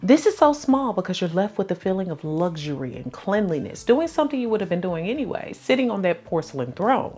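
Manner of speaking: ordinary delivery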